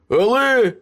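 high, loud interjection; desperate protest. A narrator's reading. Coughing Ace tries to contradict Meryl, desperate. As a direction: burst in with a pleading objection.

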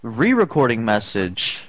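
automated prompt, press options dropping low; answering machine voice. Pretend. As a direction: recite menu like a robot system. Fake voicemail menu.

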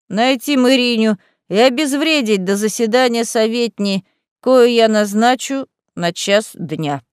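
usual speaking voice

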